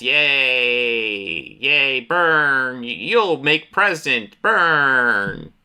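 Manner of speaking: drawn-out crowd cheer, half in earnest; mock cheering. Onlookers as he gets burned, mock cheering. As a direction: imitate a gleeful crowd, stretching the key words. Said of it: "people are just cheering as he gets burned".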